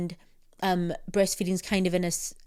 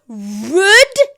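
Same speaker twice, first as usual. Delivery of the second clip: high, loud offended shriek; hammed-up indignant yell; mock outraged scream; 11 semitones higher, 11 dB louder, slower than usual. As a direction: scream it like you are deeply insulted. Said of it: "sorry if I just screamed into the mic".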